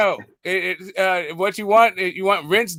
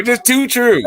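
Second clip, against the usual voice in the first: higher mock of a Baltimore accent; 4 semitones higher than usual. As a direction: copy his accent to tease him. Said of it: "Just, just one more".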